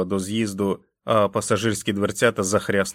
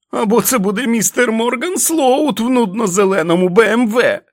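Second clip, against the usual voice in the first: furious shouting; 14 semitones higher, 8 dB louder than usual. Acted. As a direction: scream at him with each blow. Jack beating and yelling at wolf. (несамовитий лютий крик)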